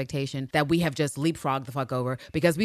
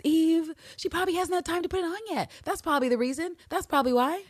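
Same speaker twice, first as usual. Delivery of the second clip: higher, lightly teasing reassurance; mock soothing Hugo; 11 semitones higher than usual. Hugo brushing off Eve's worry, higher mock-breezy voice. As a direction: play it breezy and dismissive, pitched up.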